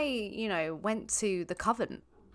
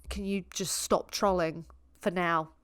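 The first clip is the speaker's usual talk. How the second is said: normal talking voice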